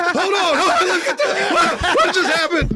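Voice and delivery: high-pitched bewildered protest; hammed-up flustered panic; mock alarm. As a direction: react in comic disbelief to the mob. Acts out his bewildered reaction.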